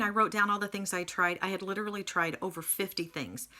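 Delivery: normal talking voice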